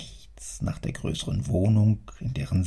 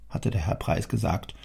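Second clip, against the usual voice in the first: usual speaking voice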